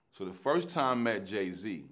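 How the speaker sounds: ordinary talk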